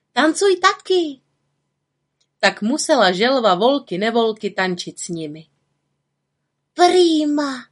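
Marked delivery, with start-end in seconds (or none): 0.1-1.2 high, bright little witch calling out. Acted. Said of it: "volala na ní malá čarodějnice". 6.7-7.7 put-on high, louder happy kids' cheer; children cheering. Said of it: "říkali Tomáš a Frona".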